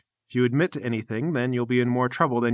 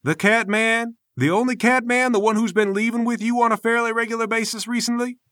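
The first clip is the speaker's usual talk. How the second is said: higher-pitched incredulous questioning; indignant master, pitched up; exasperated temple master; 9 semitones higher, 3 dB louder than usual. Acted. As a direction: press the point, pompous and exasperated. Game master voices Namir.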